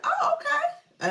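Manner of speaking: very high, drawn-out squeal; loud falsetto acknowledgement, half laughing; surprised reaction. Retold or imitated. Acting out her surprised reaction.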